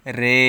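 রে with a long held e vowel; pronounced as "re". Shown as a demonstration. Teacher shows learners the special reading, রে with a drawn-out e. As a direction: hold the e of রে steadily. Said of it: "এখানে রে উচ্চারণ করতে হবে".

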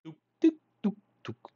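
high-pitched, quick "tuc" sounds; little tuc-tuc noises. Shown as a demonstration. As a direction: make tiny high ticking noises with the mouth. Imitates little noises while demonstrating. (ruiditos agudos de tuc, tuc)

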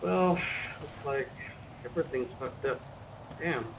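ordinary delivery

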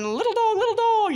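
high, sing-song call for a lost pet; mock calling out. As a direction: coax a lost dog home. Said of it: "coming out of that building going".

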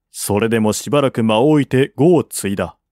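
plain reading voice

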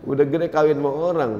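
hammed-up heartbroken wail; mock crying. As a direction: pretend to weep over a lost crush.

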